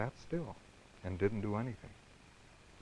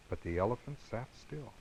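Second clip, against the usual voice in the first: usual speaking voice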